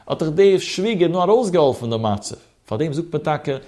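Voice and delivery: normal talking voice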